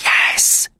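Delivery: breathy whispered yes. Acted. Gavroche answering Marius, a secret whisper. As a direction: whisper it so the spy won't hear. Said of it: "whispered Gavroche".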